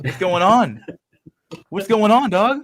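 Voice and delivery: loud, high excited yell; excited shout. Real, not acted. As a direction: call out in amused disbelief. Shouting at vote surprise.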